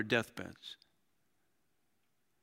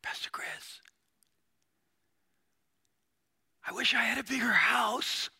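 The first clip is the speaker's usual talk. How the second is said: whispered opening rising into a high, strained wish; mock dying believer; 10 semitones higher than usual. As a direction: imitate a deathbed plea, hammed up. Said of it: "a believer in that moment say".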